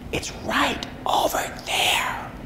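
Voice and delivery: slow, hushed whisper. Retold or imitated. Husband whispering to wife about the hidden armadillo, slow whisper. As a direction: breathe it out secretly, slowly.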